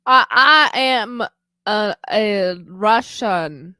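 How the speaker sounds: put-on slow, heavy Russian accent. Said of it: "can you do a good Russian accent".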